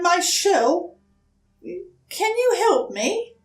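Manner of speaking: put-on high, hesitant small-child voice; young turtle politely asking a stranger for help; timid little turtle. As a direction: ask shyly, a little scared. Franklin asks the polar bear.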